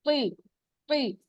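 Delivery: तुई with rounded lips and a w sound; mock wrong pronunciation. Shown as a demonstration. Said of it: "वाव की sound नहीं आनी चाहिए".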